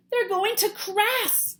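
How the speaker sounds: high, slow alarmed gasp. Acted. Stellaluna seeing her friends fall, gasping. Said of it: "gasped Stellaluna".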